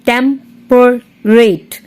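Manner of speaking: Temperate very slowly as "tem... per... rate"; three separated beats in Temperate; split into syllables. Shown as a demonstration. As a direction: sound out Temperate one syllable at a time. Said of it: "three syllables इसमें".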